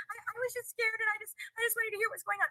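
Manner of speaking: high, soft, small stammering voice; mock-meek little voice, stammering; imitated scared excuse. Voicing her scared excuse to police.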